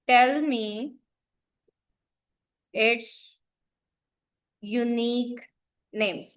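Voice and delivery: slow, halting word-by-word delivery; dictating while typing. Real, not acted. Teacher typing a request for names, slow dictation. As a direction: say each word as you type it, pausing between.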